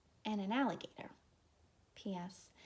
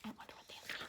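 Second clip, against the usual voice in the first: whispered aside; 8 dB quieter, much faster than usual. Real, not acted. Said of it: "teacher whispered aside".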